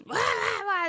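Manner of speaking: high mock insect screech; mimicking the cicada's screech, pitched high; imitated cicada screech. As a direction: screech it like a bug at the door. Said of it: "It's, like, screeching".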